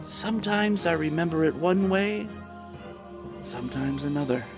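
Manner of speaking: hushed, faraway recollection; softly lost in thought; quiet musing. Acted. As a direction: fade into uncertain memory. Madman unsure of his past.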